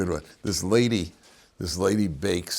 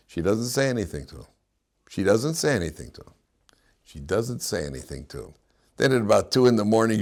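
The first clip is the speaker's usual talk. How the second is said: normal talking voice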